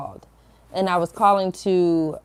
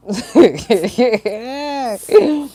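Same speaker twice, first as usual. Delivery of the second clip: loud, higher run of chuckles; burst of laughter; 5 semitones higher, 7 dB louder than usual. Real, not acted. Woman laughing at her own temper, loud cackling chuckle. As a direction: break up laughing at yourself.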